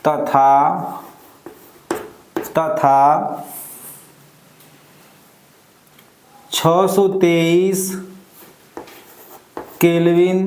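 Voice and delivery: spaced-out words, long pauses between; unhurried read-out for someone writing; slow dictation. Real, not acted. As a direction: dictate it bit by bit. Dictating reaction conditions for writing.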